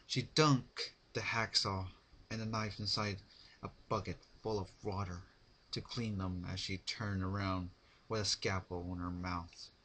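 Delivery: ordinary delivery, nothing marked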